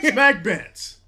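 loud laughing repeat; laughing shout. Real, not acted.

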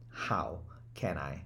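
slow whisper of "How can I?". Shown as a demonstration. Coach closing on a thought to keep, slow whisper. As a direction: breathe it softly to yourself.

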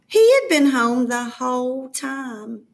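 usual speaking voice

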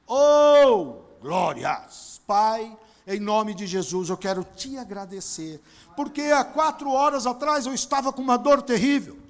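fervent praise prayer, swelling at the end; thanksgiving prayer. Acted. Pastor thanking God for healing, fervent praise. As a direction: thank God with rising fervour.

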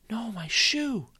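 put-on boy losing his shoe, high dismayed cry; dismayed boy's cry. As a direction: cry out as the shoe slides away. Boy loses shoe on slide.

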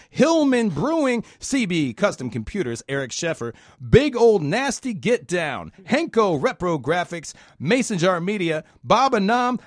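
loud, high announcer read; radio voice. Shown as a demonstration. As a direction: boom it out like a DJ. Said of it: "I'm gonna go ahead and do this in radio voice".

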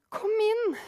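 high, loud shout of welcome; eager shout. A narrator's reading. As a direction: fling it out the door to her. Martin calls in freezing mother. (Martin roper inn den frosne kvinnen, høyt og ivrig)

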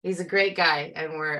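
ordinary talk, nothing marked

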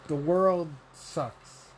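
usual speaking voice

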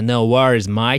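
easygoing Aussie "No worries" falling, "mate" rising; relaxed Australian "No worries, mate"; Australian accent. Retold or imitated. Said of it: "って言うんですけどね". (のんびりしたオーストラリア訛り)

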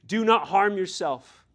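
calling out to the jailer to stop him, loud; loud shout. Acted. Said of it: "But Paul shouted with a loud voice".

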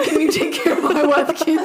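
put-on high pleading kid voice; hammed-up high plea; mock plea. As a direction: beg like a grounded kid. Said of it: "biweekly grounding".

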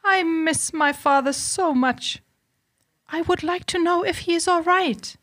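high, plaintive heroine voice; acted homesick lament, pitched well up; tearful Beauty. A narrator's reading. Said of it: "wept Beauty".

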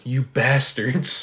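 slow, drawn-out imitated curse; lightly voiced Ash, slow; mock Ash curse. Retelling the movie scene, Ash curses slowly at his hand.

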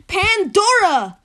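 grand announcement. Acted. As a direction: announce her like a game show host. Announcing the guest.

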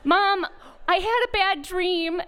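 a hint of upset small child; lightly voiced little girl. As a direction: lightly imitate a young daughter reporting a nightmare. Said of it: "our daughter, who was just a couple years older".

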